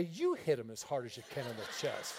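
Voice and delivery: repeating it back in disbelief, stressing you; You hit him?! said low and doubtful. Retold or imitated. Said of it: "I wanted to say".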